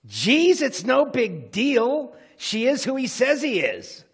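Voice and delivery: loud, high, eye-rolling protest; exasperated teen. Retold or imitated. Brother rolls his eyes at confused parents, exasperated protest. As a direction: whine it loudly at clueless parents. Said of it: "rolled his eyes at us and said".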